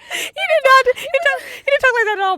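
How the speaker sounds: high squealed words through laughter; laugh-crying. Real, not acted. Mocking the guy in line, laughing shriek. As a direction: shriek it while cracking up.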